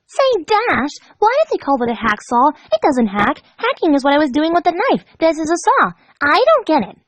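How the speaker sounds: high, chirpy and curious; cheerful Pinkie. Acted. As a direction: chat brightly as if nothing is wrong. Pinkie voiced, chatty.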